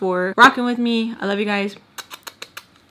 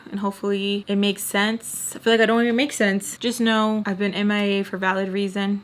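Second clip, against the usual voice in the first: ordinary talk, nothing marked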